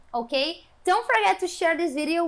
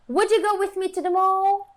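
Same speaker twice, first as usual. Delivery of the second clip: higher, louder "Would you go with me to the mall?"; question intonation; 6 semitones higher, 5 dB louder than usual. Shown as a demonstration. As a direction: lift the whole "Would you go with me to the mall?" high, keeping it up to the end. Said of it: "se atentem à entonação das frases afirmativas, negativas, interrogativas". (entonação de pergunta, voz aguda até "mall")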